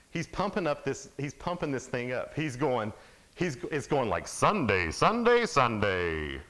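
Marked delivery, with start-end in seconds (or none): 4.3-6.4 booming announcer hyping the big show; roaring arena commercial voice, Sunday punched huge; monster truck ad. Retold or imitated. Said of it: "those commercials? Like the monster truck commercials".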